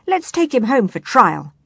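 high, loud rallying shout. A narrator's reading. Sailor turns on his thieving boss, shouting. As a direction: shout it out to the crew. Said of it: "shouted another".

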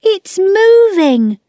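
high, loud, stretched-out cry; amazed child's shout; alarmed shout. Acted. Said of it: "Peter shouted".

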